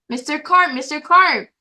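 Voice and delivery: calling out. Acted. Boy calling Mister Carp in the pool, mild mock yell. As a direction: call him across the pool. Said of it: "I yelled".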